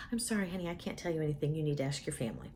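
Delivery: soft, low, apologetic; gentle nurse. Acted. Nurse telling patient to ask her family, soft apology. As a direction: let the patient down gently, very softly.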